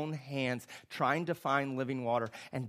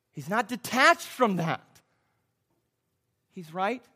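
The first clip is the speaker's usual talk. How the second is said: louder, higher and slower; emphatic preaching; 7 semitones higher, 9 dB louder than usual. Real, not acted. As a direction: drive the point home with force. Preacher rising to emphatic peak.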